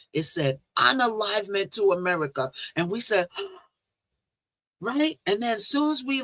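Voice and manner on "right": high shocked gasp; sharp gasp. Retold or imitated. Retelling the dream sign, a high shocked gasp. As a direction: gasp at the blood-red sign. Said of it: "like, gasp".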